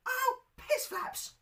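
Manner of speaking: very high, slow squeaky curse; a little boy whining a swear; squeaky child voice. Retold or imitated. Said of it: "that is how I sounded".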